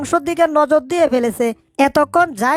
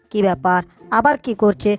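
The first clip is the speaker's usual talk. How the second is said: usual speaking voice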